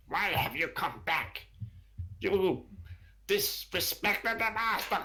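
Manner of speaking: high, accusing creature voice; guard kobold scolding the returning kobold. Acted. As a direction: challenge him high and sharp like a lizard guard.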